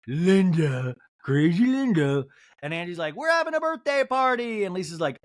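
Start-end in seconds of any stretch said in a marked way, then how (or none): 0.1-2.2 loud, put-on voice; mocking impression. Castmate mocking her friend's mom, loud put-on jeer. 3.2-4.6 mock excited announcement. Castmate rallying friends for a birthday, mock excited.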